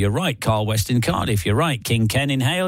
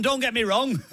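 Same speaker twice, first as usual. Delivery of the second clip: laughing; 11 semitones higher, 5 dB quieter than usual. Pretend. Acted drama line, laughing.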